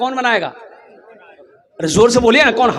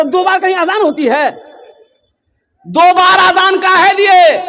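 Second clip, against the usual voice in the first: mock angry challenge; 10 semitones higher, 5 dB louder than usual. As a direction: act out a villager confronting the muezzin. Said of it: "तो मुअज्जिन से लड़ने आ गए". (गुस्से भरी चीख की नकल)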